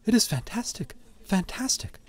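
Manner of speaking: high, soft marvelling; hushed wonder. A narrator's reading. Onlooker marvelling at the picture, high and hushed. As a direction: raise the pitch and drop the volume.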